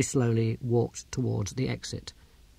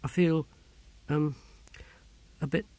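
faint, fading; 3 semitones higher, 4 dB quieter than usual. Acted. Faint, trails off before passing out.

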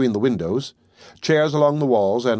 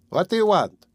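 put-on high, stern old pawnbroker demanding; severe old woman; 8 semitones higher than usual. A narrator's reading. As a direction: snap the question at him like a suspicious old woman. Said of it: "the old woman said severely".

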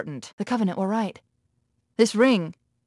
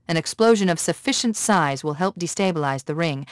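ordinary talk

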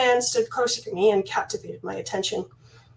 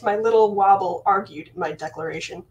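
plain reading voice

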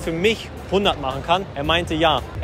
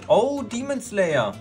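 drawn-out, higher-pitched exclamation; delighted discovery; 5 semitones higher, much slower than usual. Real, not acted. Vlogger pulls item from mystery bag, delighted surprise. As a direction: stretch it out in pleased surprise.

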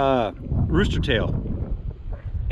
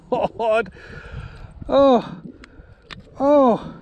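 ordinary talk

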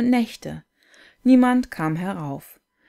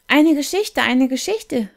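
high, loud eager calling; children begging the storyteller for a tale, high and eager; 7 semitones higher, 5 dB louder than usual. A narrator's reading. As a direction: beg for a story like excited kids. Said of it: "riefen die Kinder".

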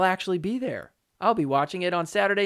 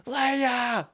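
high, drawn-out mock yell; imitated yell; 8 semitones higher than usual. Host imitating parents or coaches when she messed up, mock yell. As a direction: pitch it up like a scolding shout, without real volume. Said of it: "parents or coaches would yell".

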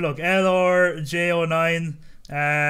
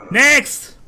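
loud, high call of next; shouted call; 9 semitones higher, 12 dB louder than usual. Acted. Border guard yelling for the next traveller, loud. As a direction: bellow it across the booth.

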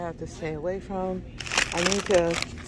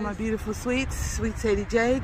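ordinary delivery

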